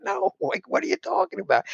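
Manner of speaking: squeaky disbelieving youngster talking back to Ted Williams; bewildered kid. Retold or imitated. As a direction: play the baffled kid protesting to his hero. Kid answers baseball legend, bewildered.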